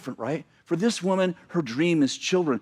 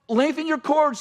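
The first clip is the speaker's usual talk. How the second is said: fired-up preaching; 11 semitones higher than usual. Real, not acted. Preacher quoting at full force.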